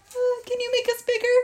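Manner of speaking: high-pitched shrunken-person plea; tiny voice. Acted. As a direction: ask as someone mouse-sized, very high. Tiny speaker asks for help.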